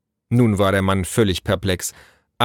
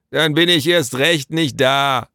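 higher, drawn-out shout back; calling back; 6 semitones higher, 4 dB louder than usual. A narrator's reading. Father refusing the king's messenger from indoors, calling. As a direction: call out a slow refusal. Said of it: "rief sein Vater".

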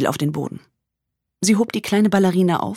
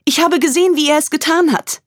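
frightened; 12 semitones higher, 6 dB louder than usual. Acted. Frightened witness on the phone.

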